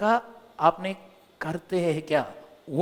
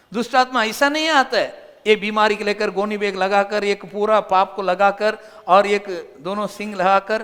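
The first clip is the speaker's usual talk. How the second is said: louder, raised preaching voice; preaching at full force; 3 semitones higher, 7 dB louder than usual. Acted. Preacher mocks the devil's image to the church, loud preaching. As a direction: build it up for the congregation.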